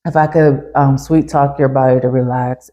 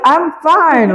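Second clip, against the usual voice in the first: much higher, bright insistence on being fine; excited reply; 12 semitones higher than usual. Retold or imitated. Therapist reassures a puzzled client, excited and much higher. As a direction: gush it, barely containing the thrill. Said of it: "I'm just super excited".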